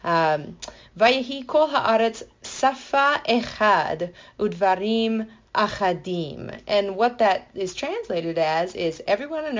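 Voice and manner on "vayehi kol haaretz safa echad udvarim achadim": slow, careful Hebrew recitation; Hebrew reading. Shown as a demonstration. Teacher reading the Genesis verse in Hebrew, slow and careful. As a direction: read the Hebrew line slowly and deliberately. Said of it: "a fun way that they say things in Hebrew".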